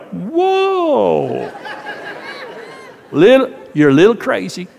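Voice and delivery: high "Whoa" breaking into a laugh, then teasing; a wife caught off guard, laughing at her husband; startled laugh. Retold or imitated. Wife reacts to husband's outburst.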